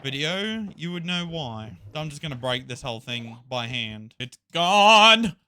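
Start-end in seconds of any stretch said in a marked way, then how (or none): 4.2-5.3 loud, raised shout with GONE held out; big hammed-up victory yell; triumphant shout. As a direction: crow that it's GONE. Triumphant shout at destroyed farm.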